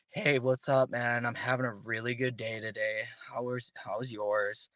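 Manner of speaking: low, soft, deadened cheerful words; mismatched glum greeting. Acted. Speaker acts somebody claiming a good day, in a glum low murmur. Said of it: "it'd be weird, right?".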